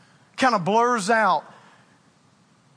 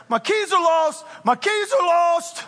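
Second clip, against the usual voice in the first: mock panic; 6 semitones higher than usual. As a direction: play up the panic over lost keys. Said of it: "I kinda go into a panic".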